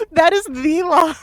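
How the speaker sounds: laughing loudly through the words; cracking up at the line; high, loud laugh. Real, not acted. Laughing hard.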